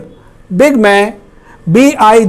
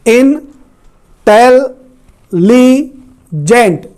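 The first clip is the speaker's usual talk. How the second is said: "Intelligent" broken into four slow, separated syllables; 3 semitones higher, much slower than usual. Shown as a demonstration. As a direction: say "intelligent" in four drawn-out pieces with pauses between. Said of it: "चार syllable हैं".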